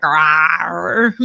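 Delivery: playful imitated stomach growl; stomach growl imitation. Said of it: "if you heard my stomach just now".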